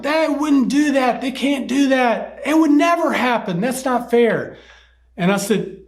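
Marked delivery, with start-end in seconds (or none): 0.0-4.6 put-on high-pitched chorus of outraged kids; imitated shouting. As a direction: mimic indignant second graders crying out. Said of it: "they're all shouting".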